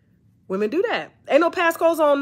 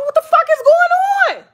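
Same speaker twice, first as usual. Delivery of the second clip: much higher, louder shouting question; exasperated yell; 13 semitones higher, 5 dB louder than usual. Retold or imitated. Boss walking in on her out-of-control team, high yell. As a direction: explode at the chaos. Boss arriving to chaos at work.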